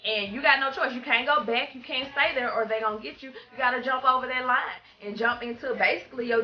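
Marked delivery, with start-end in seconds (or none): none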